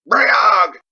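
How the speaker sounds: loud high war cry; battle cry. A narrator's reading. Warriors charging into smoke, shouting the war cry. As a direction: roar the clan name into the smoke.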